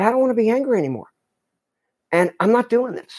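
quick, bright with resolve; sudden resolve. Retold or imitated. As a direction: blurt out a relieved decision. Waking up with a realization.

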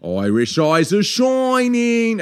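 high, drawn-out stage Irish lilt; attempted Irish accent. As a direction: stretch it out in a bad Irish accent. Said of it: "do an Irish accent".

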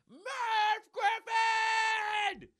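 very high, drawn-out scream; screaming at the top of lungs. Acted. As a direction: stretch the name out in a long scream. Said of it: "at the top of their lungs".

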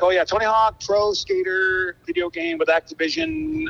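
high, slow, drawn-out label-guy voice; a lightly hammed-up casual label rep; mock label guy. Label rep casually pitching video game.

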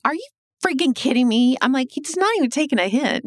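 can you believe this guy; mock exasperated outburst at the coworker; exasperated disbelief. Exasperated reaction to more gifts.

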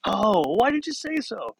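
high, stretched-out "oh"; exasperated coach. Retold or imitated. Coach to the injured boy, exasperated realization. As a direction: play a coach suddenly getting it, high and slow. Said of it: "It's like".

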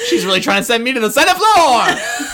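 high, loud wail through laughter; laughing outcry. Real, not acted. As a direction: shriek it while cracking up. Laughing outcry at being accused.

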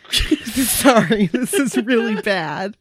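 trying to talk through laughter; laughing apology. Real, not acted. Host apologizing for how bad this is, half laughing. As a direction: apologize while fighting off giggles.

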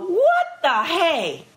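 high, drawn-out "what the"; retold disbelief. Actress recalls watching the frantic script fix, incredulous. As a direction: retell it stretched out and baffled.

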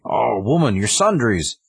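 mock-heroic call; retold barbarian hero. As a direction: call after her, lightly hammed-up. Conan calls to fleeing woman.

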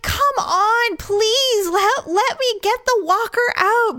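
high, pleading plea with a hint of a whine. To the old man with his walker, high begging plea. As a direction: beg him to let you help. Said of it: "I just am like".

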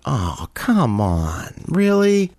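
drawn-out groaning protest, leaping up on the last word; exasperated. Retold or imitated. Server grumbles at being sat a late table, exasperated. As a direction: let down, stretch it out, then go high in disbelief.

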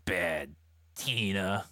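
very slow, syllable-by-syllable seething; furious growl. A narrator's reading. Wounded gang boss names his enemy, furious growl. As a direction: growl each syllable separately. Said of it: "he growled in anger and fury".

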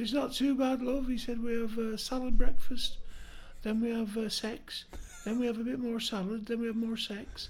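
high, loud northern bloke telling his news; cheery ghost uncle. Acted. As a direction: deliver it chirpy and proud. Arthur answering in joke.

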